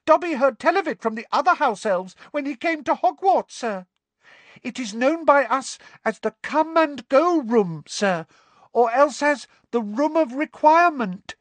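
very high, eager elf voice; squeaky house elf. A narrator's reading. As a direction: share the secret proudly. Dobby voiced in story.

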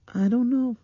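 put-on very high, loud child's voice; little girl. A narrator's reading. Blind girl answering without turning, high child's voice. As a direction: pitch it way up as a child.

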